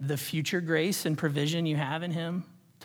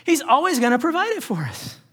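trusting believer; 8 semitones higher, 4 dB louder, faster than usual. Pretend. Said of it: "we take a moment and say".

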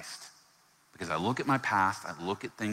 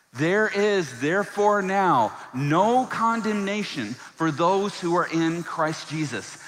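higher, louder, slow and emphatic; like we believe it; 9 semitones higher, 7 dB louder, slower than usual. Shown as a demonstration. Pastor rallying the church to believe it, loud declaration. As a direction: declare it with conviction. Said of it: "can we say it like we actually believe it?".

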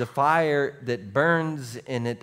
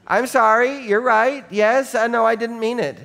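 appeasing; 7 semitones higher, 6 dB louder than usual. Retold or imitated. Placating a screaming person.

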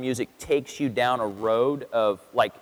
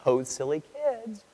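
mock dismissive God; 3 semitones higher, slower than usual. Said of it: "he's probably not up there all".

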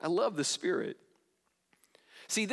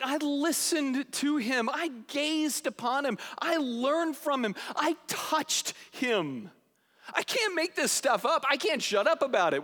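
awestruck testimony; 6 semitones higher than usual. Retold or imitated. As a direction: linger on every memory in wonder. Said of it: "He's like".

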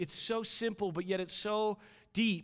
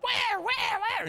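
put-on high, loud little-girl squawk; toddler daughter; 8 dB louder, much slower than usual. Two-year-old at dinner asking about the animal, toddler shriek. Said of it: "And she sounds like that".

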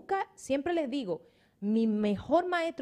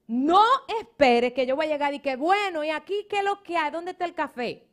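raised, louder mock of a demanding visitor; mocking guest; 7 semitones higher, 8 dB louder than usual. Pastor mocking a guest who arrives empty-handed, raised louder voice. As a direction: ham up the freeloading guest.

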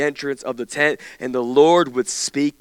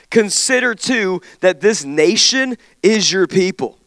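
high, loud, urgent plea to God; impassioned petition; pleading Moses; 7 semitones higher, 6 dB louder than usual. Acted. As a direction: read Moses begging God for his presence. Moses pleading in scripture reading.

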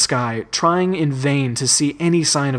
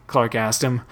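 ordinary delivery, nothing marked

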